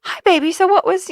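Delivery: high, cooing phone voice; imitating a sweet grandmother on the phone; suddenly sweet. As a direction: switch to a sugary greeting. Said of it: "she'd just be like".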